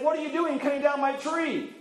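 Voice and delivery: high, put-out little elf voice; lightly voiced, indignant elf. Elf scolds the woodcutter.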